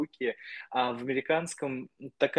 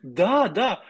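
normal talking voice

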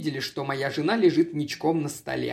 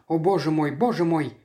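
plain reading voice

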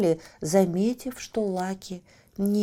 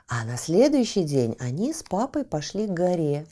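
plain reading voice

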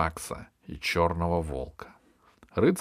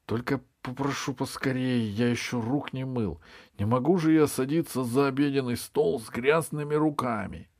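higher-voiced king, hurried and peevish; complaining royal voice, pitched up; fussy king; 7 semitones higher than usual. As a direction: play a king impatient to get washed before dinner.

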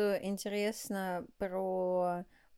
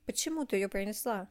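ordinary talk, nothing marked

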